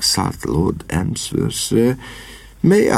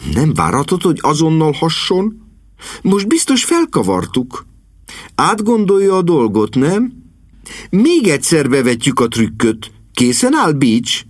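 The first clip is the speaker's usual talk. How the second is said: put-on high, eager girl's voice; rally the helpers in a high, lively voice; spirited young niece; 10 semitones higher than usual. A narrator's reading. Niece urging uncle and butler to retry, spirited.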